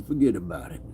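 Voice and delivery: low, soft movie Italian "Forget about it"; movie Italian accent. Shown as a demonstration. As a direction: do the movie Italian, De Niro style. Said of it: "the movie Italian".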